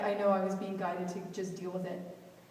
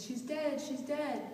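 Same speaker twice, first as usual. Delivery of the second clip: high-pitched mock cry, volume held back; retold screaming; 6 semitones higher, slower than usual. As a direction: imitate a bawling grandmother without shouting. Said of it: "she's screaming".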